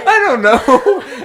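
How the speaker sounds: slow words through laughter; laughing helplessly at himself. Real, not acted. Brother laughing while asked why he cried, slow laughing.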